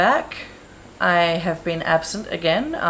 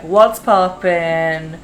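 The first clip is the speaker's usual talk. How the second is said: put-on Boston accent; 8 dB louder, much slower than usual. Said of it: "I'm trying to do her, like, Boston accent".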